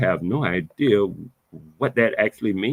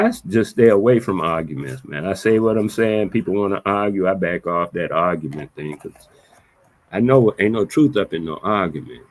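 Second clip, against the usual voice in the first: ordinary talk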